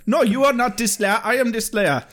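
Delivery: hammed-up Hollywood Jamaican accent; Kendra. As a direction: answer back in a badly faked Jamaican accent. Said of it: "that might be a better accent".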